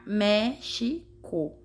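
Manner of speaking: "México" slowly split as Mé, xi, co; syllable by syllable. Shown as a demonstration. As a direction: count out "México" with a pause after each syllable. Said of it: "Contou as sílabas daquela forma".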